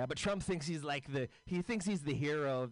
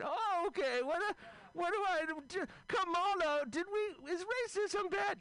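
put-on much higher, doddering old man voice; a befuddled president, pitched way up; confused old Joe. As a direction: play him lost and calling for help. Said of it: "So here's my impression of".

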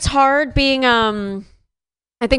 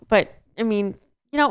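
usual speaking voice

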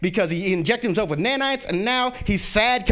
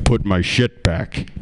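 much lower, slow, commanding hero voice; deep superhero calm; 12 semitones lower, 3 dB louder, slower than usual. Retold or imitated. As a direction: drop the voice low and slow like an unbothered alien. Icon floats out of the house.

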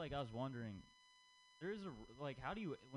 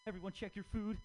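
mock announcement; 9 semitones higher, 5 dB louder than usual. Imagined kid warning at lunch.

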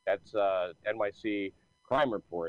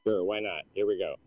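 normal talking voice

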